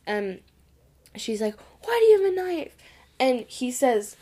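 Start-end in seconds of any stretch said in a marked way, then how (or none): 1.8-2.8 mock-worried grandma, pitched higher and louder; grandma's alarmed question. Nan catching her grandson with a knife, mock-alarmed. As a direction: ask it like a startled nan who found a knife.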